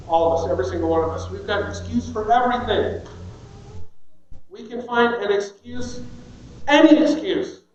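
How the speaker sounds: usual speaking voice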